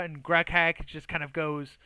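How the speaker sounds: ordinary talk, nothing marked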